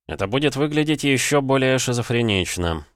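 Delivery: usual speaking voice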